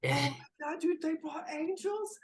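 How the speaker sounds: high-pitched, astonished sailor; freaked-out amazement. Acted. Sailor seeing a figure fizzle into existence, freaked-out amazement. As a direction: start as a loud high cry, then ease off in volume.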